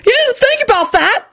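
very high-pitched outraged yell; yelled disbelief. A narrator's reading. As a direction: throw it back as an outraged question. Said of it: "Alex yelled".

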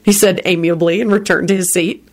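ordinary delivery, nothing marked

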